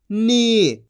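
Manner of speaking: नी with a held ī; long drawn-out vowel. Shown as a demonstration. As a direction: stretch नी into a long "neee". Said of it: "खींच के पढ़ा जाता है".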